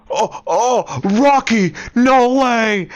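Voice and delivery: high, loud rising cry of joy; overjoyed. Retold or imitated. Grace finding Rocky alive, crying out high and loud with joy. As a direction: burst out in disbelieving delight. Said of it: "Grace was like".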